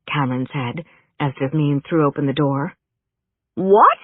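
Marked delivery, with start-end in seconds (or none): 3.6-4.0 higher, louder cry of disbelief; shriek. A narrator's reading. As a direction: shriek it out in shock. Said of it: "she shrieked".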